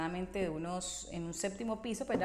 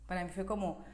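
ordinary talk

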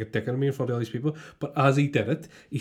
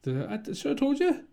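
mirror voice; 14 semitones higher than usual. Pretend. Mirror self answering.

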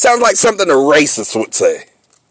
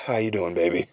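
much lower and softer, smooth ladies'-man line; lightly mocking seductive hello; smooth flirty greeting; 11 semitones lower, 13 dB quieter than usual. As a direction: purr it at his wife like a charmer. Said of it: "the way you said it".

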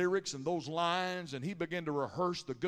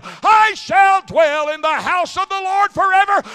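shouted, much higher and louder, slowing at the end; full-force preaching; 7 semitones higher, 14 dB louder than usual. Acted. Preacher lifting the crowd with Psalm 23, thundering. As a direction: roar it out like a revival climax.

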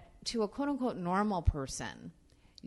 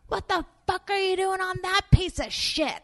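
high, loud, laughing mock Roseanne; imitating Roseanne, loud and amused; brash laughing star; 12 dB louder, slower than usual. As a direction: roar it with delighted disbelief.

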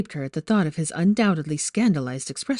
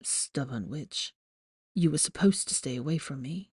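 fondly; 3 semitones lower, 8 dB quieter than usual. A narrator's reading. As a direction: tease her gently with affection. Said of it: "Why did he sound so fond?".

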